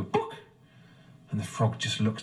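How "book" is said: high, squeaky chicken clucking. Acted. Chicken voice in joke.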